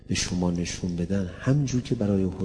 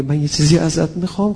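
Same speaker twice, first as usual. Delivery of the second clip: Hussein asking boldly; 8 semitones higher, 9 dB louder than usual. Retold or imitated. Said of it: "حسینم وقتی به خدا میگه".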